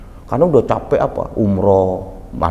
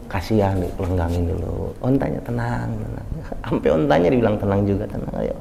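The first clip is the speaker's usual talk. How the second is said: low, soft, gentle soothing; calm the camel tenderly; 7 semitones lower, 7 dB quieter than usual. Retold or imitated. The Prophet gently soothing his camel, low and soft.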